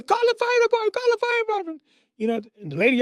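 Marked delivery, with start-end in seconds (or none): 0.0-1.8 very high, frantic holler; mother screaming. Retold or imitated. Mother as the mattress burns, screaming. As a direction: imitate a panicked mom calling for help. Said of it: "screaming, hollering".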